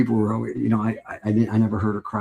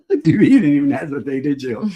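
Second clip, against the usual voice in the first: high, laughing teasing; 6 semitones higher, 6 dB louder than usual. Real, not acted. Father laughing at daughter's instant answer, high and loud. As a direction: crack up at her quick reply.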